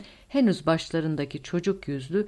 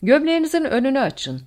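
raised, louder military command; giving an order; 9 semitones higher, 8 dB louder than usual. A narrator's reading. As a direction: order a subordinate with firm authority. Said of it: "Skorzen emir verdi".

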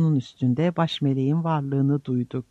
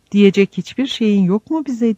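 higher, louder cry of protest; narrated shout, moderately raised; 6 semitones higher, 5 dB louder than usual. A narrator's reading. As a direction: call out a pleading question, raised in pitch and volume. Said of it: "diye bağırdı Giles".

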